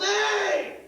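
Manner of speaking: high, sharp yelp of pain; startled yelp. Acted. Deaf guy yelps when the doctor cracks him with a board. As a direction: yelp as if struck from behind.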